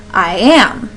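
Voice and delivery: "I am" light on I, stressed on AM; stressed second syllable. Shown as a demonstration. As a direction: lean on "am" in "I am". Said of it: "is how that stressing would sound".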